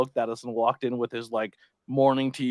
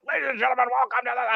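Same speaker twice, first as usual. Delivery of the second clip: much higher ring announcer call; mock announcer voice; 12 semitones higher, faster than usual. Mocking commentators who fake an announcer voice. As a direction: parody a booming commentator intro. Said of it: "they're like".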